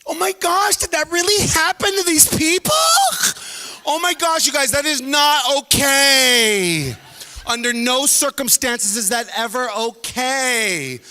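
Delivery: high loud scandalized slow voice; shocked prude. Retold or imitated. Offended audience member, high loud scandalized.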